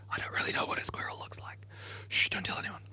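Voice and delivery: fast unvoiced whisper. Real, not acted. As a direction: whisper it like a secret to chat. Secret aside to chat.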